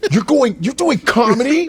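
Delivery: high, mock-astonished question; high imitated sibling disbelief; incredulous sister. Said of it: "I can remember her face".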